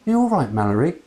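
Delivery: mild concerned aunt; lightly voiced kind woman. A narrator's reading. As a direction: ask it gently. Woman asks Mallory.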